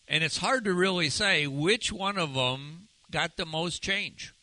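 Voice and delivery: normal talking voice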